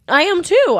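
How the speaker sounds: fibbing about her age, up high; eager fib. Retold or imitated. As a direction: bluff it brightly, up high. Lying about age, retold.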